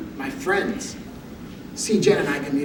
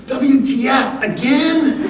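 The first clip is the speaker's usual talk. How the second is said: slow, higher, louder letters spelled out in frustration; frustrated exclaim; 6 semitones higher, 4 dB louder, much slower than usual. Retold or imitated. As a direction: spell it out, exasperated, half laughing. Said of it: "Jen exclaims with frustration".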